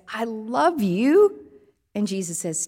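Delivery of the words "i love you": a slow, louder, earnest I love you; slow earnest answer. Acted. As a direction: stretch it out and mean it. Peter answers Jesus a second time.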